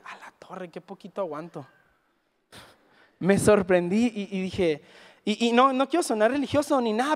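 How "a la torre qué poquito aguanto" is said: much softer, lower rueful remark; quiet to himself. Retold or imitated. Driving home alone, talking to himself much softer. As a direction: say it under your breath, rueful.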